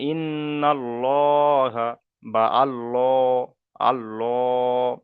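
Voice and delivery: ইন্নাল্লাহা and আল্লাহ drawn out slowly with a rounded "lo"; slow Quranic demo, আল্লাহ as "al-LOOO"; full "lo" recitation. Shown as a demonstration. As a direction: stretch the heavy "LOO" in আল্লাহ. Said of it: "ল উচ্চারণ করে পড়তে হবে".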